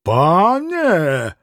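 drawn-out, warning exclamation; mildly menacing shout; threatening cry. A narrator's reading. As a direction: stretch the word into a warning. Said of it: "прокричали оба пана с угрозою".

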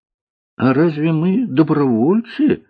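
high, slow question in surprise; surprised. A narrator's reading. Girl to cook about being volunteers, surprised. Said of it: "удивилась Алиса".

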